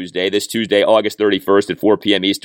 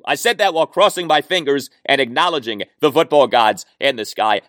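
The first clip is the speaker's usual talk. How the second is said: normal talking voice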